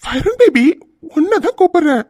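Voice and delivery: teasing a friend on the phone in a woman's voice; ladies' voice. Acted. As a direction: coo his name like a flirty woman. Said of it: "இப்ப எதுக்குடா நீ ladies வாய்ஸ்ல பேசுன?".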